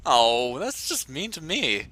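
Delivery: usual speaking voice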